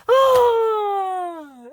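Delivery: high, drawn-out "ah" sliding down; mock falling scream. Storyteller voices him going off the bridge, mock scream. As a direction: imitate a long falling scream.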